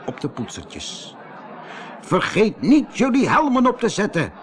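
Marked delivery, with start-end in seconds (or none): 2.0-4.3 high-pitched bossy call. Acted. Gang leader readies the smear-brushes, high commanding call. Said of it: "riep smeerpoets nummer één".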